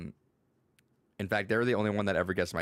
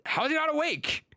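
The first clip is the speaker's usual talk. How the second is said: high-pitched incredulous cry; exaggerated squeal of confusion; mind-blown disbelief. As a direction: retell utter bafflement, voice shooting way up. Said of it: "my mind broke".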